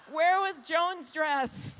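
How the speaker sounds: loud, high, slow comic call; mock eager searching. Speaker mimics herself hunting through the Mondale exhibit, mock eager. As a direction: call it out as an over-keen museum visitor.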